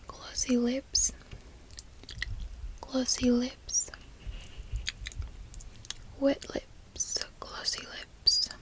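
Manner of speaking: whispered trigger words with a hint of Australian; lightly faked Australian accent, each phrase left to linger; bootleg Australian accent. Shown as a demonstration. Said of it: "In my little bootleg accent".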